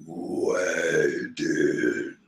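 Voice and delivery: deep drawn-out demon growl. Retold or imitated. Big Mom growling at her crew, a deep slow demon voice. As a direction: rumble it low like a demon. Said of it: "her old demon voice".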